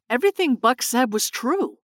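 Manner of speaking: plain reading voice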